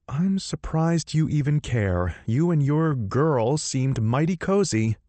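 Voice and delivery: a cold jealous jab; frosty heroine. Acted.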